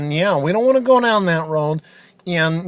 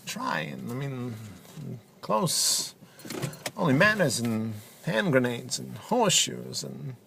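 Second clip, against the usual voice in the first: softer, halting, oddly paused delivery; a hint of Christopher Walken, choppy pauses; deadpan Walken-style commentator; 5 semitones lower, 10 dB quieter than usual. Softball pundit answering host Dutch in halting, low-key impression.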